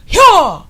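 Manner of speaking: sharp high karate kiai; karate yell. Retold or imitated. Instructor demonstrating the karate shout, loud yaw. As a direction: belt out a martial arts yaw. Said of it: "Do I have to make that sound?".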